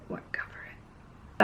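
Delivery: breathless whispered aside, barely audible. Retold or imitated. Stressed customer whispering her doubt to herself.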